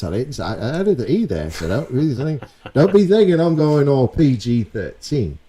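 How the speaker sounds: hammed-up high British accent, pleading for likes; cheeky British accent begging viewers to subscribe; put-on British accent. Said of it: "Let me know if this accent is shite".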